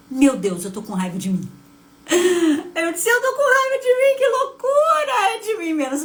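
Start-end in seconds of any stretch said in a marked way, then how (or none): 2.1-6.0 much higher and louder, breaking with emotion; stunned realization. Retold or imitated. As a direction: cry out in shocked discovery. Descoberta súbita da raiva. (surpresa aguda e emocionada, quase chorando)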